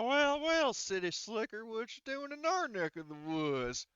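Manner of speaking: high, slow drawling hillbilly greeting; gruff local yokel. Acted. Villager taunting the newcomer in a slow drawling yokel voice. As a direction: sneer at the city slicker, drawn out. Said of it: "he's like".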